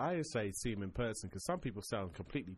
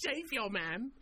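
much higher, giggly repeat; squeaky high voice; 12 semitones higher, slower than usual. Acted. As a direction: squeak it out through laughter.

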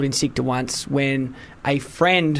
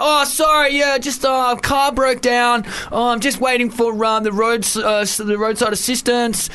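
fake excuse; 8 semitones higher, slower than usual. Young worker lying to his manager on the phone, high put-on innocence. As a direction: sell the lie brightly to the boss.

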